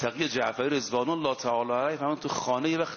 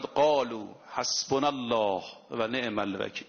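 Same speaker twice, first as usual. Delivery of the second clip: Quranic recitation; 4 semitones lower than usual. Acted. Quoting the Quran in Arabic.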